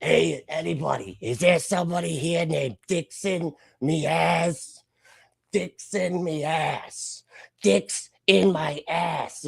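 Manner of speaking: high, slow cartoon kid calling out; squeaky cartoon boy prank-calling a bar; Bart Simpson voice. Streamer reading a chat prank name as Bart Simpson. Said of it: "Bart Simpson, you nailed it".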